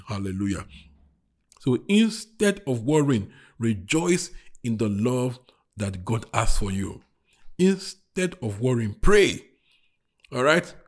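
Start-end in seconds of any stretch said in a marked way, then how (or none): 1.6-9.4 measured preaching, building to a loud 'pray'; emphatic exhortation. Real, not acted. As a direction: drive the instruction home to the congregation.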